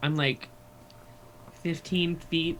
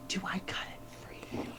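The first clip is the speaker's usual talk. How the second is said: slow unvoiced whisper; 3 semitones higher, 9 dB quieter, slower than usual. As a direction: hush it, barely audible. Whispering while invisible.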